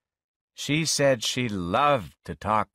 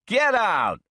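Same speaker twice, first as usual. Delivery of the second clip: high, louder, furious command; angry shout; 11 semitones higher, 4 dB louder than usual. Acted. Man ordering his girlfriend out, high and louder. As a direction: throw her out.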